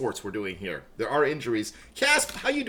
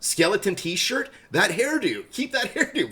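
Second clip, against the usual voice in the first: mock gushing praise; 8 semitones higher, slower than usual. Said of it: "and I'm like".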